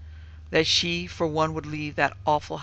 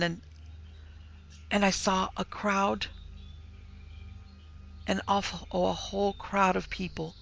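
higher, halting, breathless account; panting; 5 semitones higher than usual. A narrator's reading. Frightened daughter telling of the crowd, panting. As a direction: break it into short, gasped pieces with stumbles. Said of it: "she panted".

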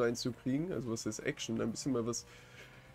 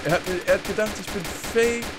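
excited; 8 semitones higher, 8 dB louder than usual. Real, not acted. Excited retelling of fooling cop.

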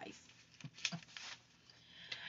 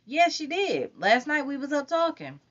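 put-on much higher, insistent girl; higher girl insisting; Lauren's voice; 11 semitones higher than usual. A narrator's reading. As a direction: push back high and sure.

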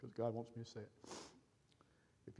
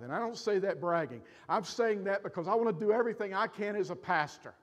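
loud, higher-pitched pulpit urging; raised, emphatic preacher voice; impassioned preaching; 5 semitones higher, 8 dB louder than usual. Real, not acted. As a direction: preach it forcefully to the congregation. Preaches at full force.

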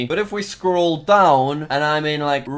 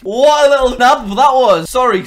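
much louder and higher, half laughing; gleeful self-mockery; 10 semitones higher, 9 dB louder than usual. Real, not acted. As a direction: call himself out with glee. Caught copying Sunday's intro.

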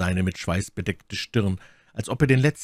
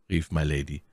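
ordinary delivery, nothing marked

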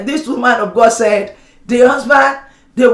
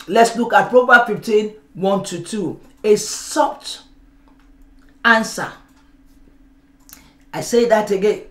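ordinary delivery

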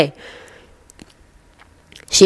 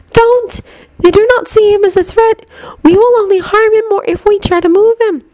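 put-on high-pitched girl's character voice; Luna, urgent. A narrator's reading. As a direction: object firmly in a much higher voice.

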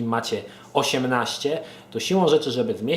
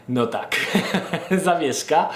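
real chuckle between the words; amused mental blank; 5 semitones higher than usual. Real, not acted. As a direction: laugh at your own brain freeze. Laughs at own mental blank. (śmiech z własnej zawieszki)

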